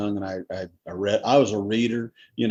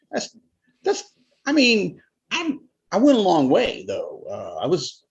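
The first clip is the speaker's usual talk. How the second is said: high squeaky excuse; 9 semitones higher than usual. Retold or imitated. Husband squeaking excuses to his wife about her car. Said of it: "And I'm like".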